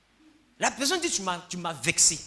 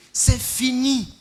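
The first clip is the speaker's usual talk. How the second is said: "C'est fini" stretched out slowly and louder; slow, drawn-out final word; 4 semitones higher, 6 dB louder, much slower than usual. Shown as a demonstration. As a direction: let "C'est fini" land slow and heavy, closing the matter.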